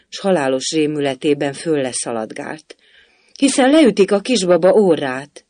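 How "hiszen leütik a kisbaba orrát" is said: higher, louder alarmed cry; kiáltva. A narrator's reading. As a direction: call out to warn them. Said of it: "kiáltotta". (Alice rémülten kiált a hercegnőnek)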